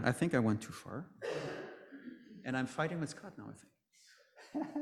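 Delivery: trailing off into a soft laugh; quiet aside. Real, not acted. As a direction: mutter it half to yourself. Aside about slides.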